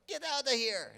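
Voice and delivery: high, loud mock Chinese accent; outraged vendor, hammed-up Chinese accent; bad Chinese accent. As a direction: yell it in a badly faked Chinese accent. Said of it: "that's my Chinese accent, by the way. I know it was horrible".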